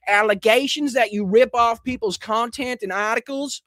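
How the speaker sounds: put-on slow, high mix of Boston and Georgia accent. Host reading tweet aloud slips into Boston and Georgia accent. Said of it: "with a Boston and Georgia accent somehow".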